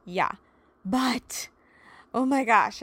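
normal talking voice

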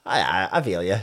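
low, laughing sympathy; lightly laughing agreement. Retold or imitated. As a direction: chuckle through it. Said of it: "I was like".

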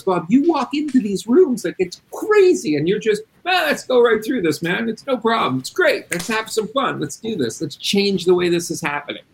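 high, excited imitation of a keen coworker; imitating Ricardo. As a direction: play the eager employee hyped to go in. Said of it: "He goes".

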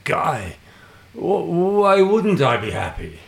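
high, loud mock-Argentinian accent; hammed-up Argentinian accent; bad Argentinian accent. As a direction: badly attempt an Argentinian accent, pitched well up. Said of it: "very bad attempt at his accent".

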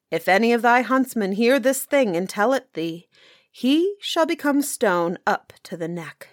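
usual speaking voice

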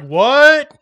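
high, loud, baffled; mock confused kid. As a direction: play the stunned kid. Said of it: "And this kid's like".

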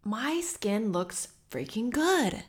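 soft, slow, admiring murmur; awed self-talk. Retold or imitated. To herself at the mirror, soft, slow and admiring. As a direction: savor it, admiring yourself in the mirror. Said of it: "I'm like".

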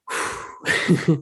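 long, voiceless exhale; heavy sigh. Real, not acted. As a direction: let out a long breathy sigh. Exhales after heavy subject.

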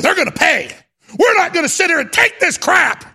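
high, loud threatening yell; furious shout. Real, not acted. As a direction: rage at the enemy, full voice. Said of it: "getting really pissed off".

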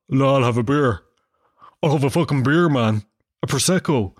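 a lightly gruff lads' voice ordering beer; hint of a hard man at the bar; macho bar order. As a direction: bluff it out like one of the boys. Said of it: "tell anybody I'll have a Prosecco".

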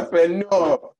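Entrepreneur said high and loud in a Nigerian accent; hammed-up Nigerian accent on Entrepreneur. Shown as a demonstration. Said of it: "That's my Nigerian accent".

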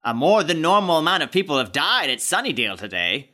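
put-on news announcer; mock newsreader. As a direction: deliver it like a TV news anchor. Imitates the news report on event.

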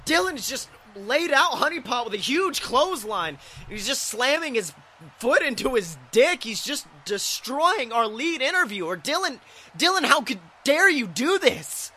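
high, agitated commentary; horrified play-by-play. Acted. Announcer watching his lead interviewer get destroyed, horrified. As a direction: call the attack in alarmed disbelief. Announcer calls attack in horror.

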